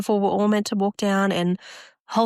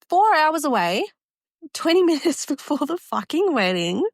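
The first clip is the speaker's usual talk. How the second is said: higher-pitched, laughing disbelief; incredulous outrage; 7 semitones higher than usual. Real, not acted. Bride telling how groom forgot his pants, incredulous. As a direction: hammer home the absurdity, higher than usual.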